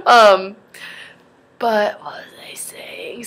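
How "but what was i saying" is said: slow whisper to herself; whispered aside. Real, not acted. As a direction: breathe it, lost in thought. Loses her train of thought.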